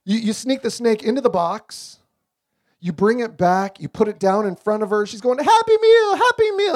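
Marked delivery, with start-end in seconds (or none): 5.4-6.7 put-on much higher, louder squeal of a little girl; excited toddler. Imitating a thrilled toddler at McDonald's, high squeal. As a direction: squeal it like a thrilled three-year-old. Daughter voiced again.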